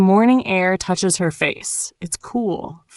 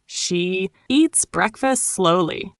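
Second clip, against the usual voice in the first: slow, word by word; slow repeat; slower than usual. Shown as a demonstration. Teacher says it again for learners, slowly. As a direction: hold "she" and space out each word.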